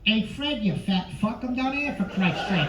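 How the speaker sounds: a hint of a wiseguy neighbor calling up; lightly voiced Pesci shouting for Fred; Pesci as Barney Rubble. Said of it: "Pesci as Barney".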